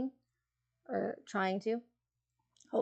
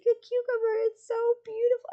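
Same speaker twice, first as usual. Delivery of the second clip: dreamy sleepy friend; 7 dB louder than usual. Retold or imitated. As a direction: gush over it like it's a masterpiece, way up high. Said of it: "She's like".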